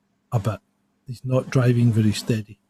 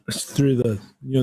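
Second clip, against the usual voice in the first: ordinary talk, nothing marked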